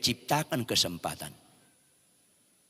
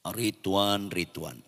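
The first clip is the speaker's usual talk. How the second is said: low, much softer call of the name; voice of God; 6 semitones lower, 6 dB quieter, much slower than usual. Retold or imitated. God answers the weeping preacher, low and soft. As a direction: call him gently and deep. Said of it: "Apa kata Tuhan?".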